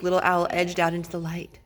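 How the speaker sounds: ordinary delivery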